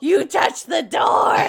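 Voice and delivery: slow, loud, pointed blame; drawn-out, loud accusing shout; loud accusation. Acted. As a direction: call him out in front of everyone. Accusing in character.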